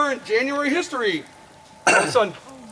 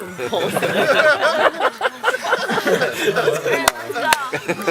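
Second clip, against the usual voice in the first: laughing; 5 semitones higher than usual. Real, not acted. Laughing at his own joke.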